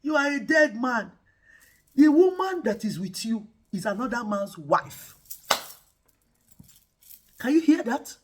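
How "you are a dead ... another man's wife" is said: loud, booming, higher voice of God; God booms at the king in his dream; God's warning. Retold or imitated. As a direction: thunder the warning at Abimelech. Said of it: "God appears to him in a dream and says".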